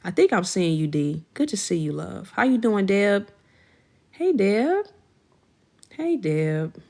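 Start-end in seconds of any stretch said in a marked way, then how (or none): 4.1-4.8 sing-song high greeting with a rising end; high excited hello. Real, not acted. Greeting viewer Deb in chat, high chirpy hello.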